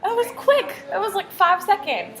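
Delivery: amazed at how fast it went; surprised. Real, not acted. Student finished sort surprisingly fast.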